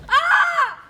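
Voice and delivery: sharp high loud yelp; startled shout. Acted. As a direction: jump back with a yell. Woman kisses his foot.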